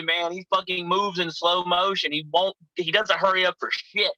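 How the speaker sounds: ordinary delivery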